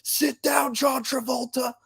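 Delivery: high, drawn-out heckler yell; high mocking heckle. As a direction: bellow it like a drunk fan. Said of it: "he goes".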